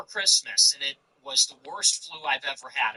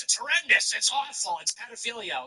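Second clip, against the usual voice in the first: high-pitched hammed-up horror, easing off; sarcastic pearl-clutching; mock outrage; 6 semitones higher than usual. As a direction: mimic a scandalized objector in a raised pitch. Mimics outraged critics, then says relax.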